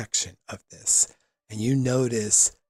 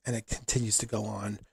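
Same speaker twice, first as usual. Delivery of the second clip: usual speaking voice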